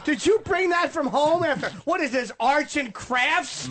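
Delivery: high voice through laughter; mock the drinker while laughing; hammed-up laughing ridicule of Colin.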